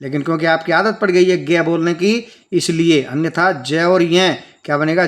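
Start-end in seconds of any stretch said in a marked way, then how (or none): none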